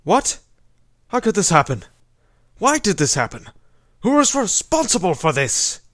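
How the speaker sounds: stunned, demanding outburst; acted outrage at terrible news; shocked rage. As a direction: demand answers in disbelief and mounting anger. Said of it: "find justice by rage".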